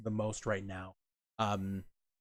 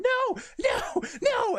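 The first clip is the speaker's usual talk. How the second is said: very high, louder wail of no; retold wail; 4 dB louder, much slower than usual. Imitating the teammate's despair when she loses the last kill: high wail. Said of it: "Mousy just going".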